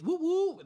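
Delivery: high, loud "woo woo"; cheering whoop. Real, not acted. Reader cheering their alma mater mid-bio, high whoop. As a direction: whoop proudly for your school. Cheers own college while reading.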